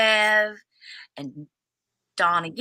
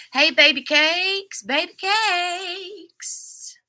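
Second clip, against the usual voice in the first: playful sing-song greeting; 9 semitones higher, much slower than usual. Real, not acted. Greeting her sister in chat with a slow, cooing pet name. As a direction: coo it at her, slow and sweet.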